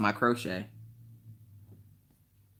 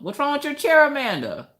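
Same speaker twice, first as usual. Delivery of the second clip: very high, squeaky put-on voice; high-pitched comic questioning; mock concern; 5 dB louder than usual. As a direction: squeal it in playful reproach. Mock questioning Amanda about chair.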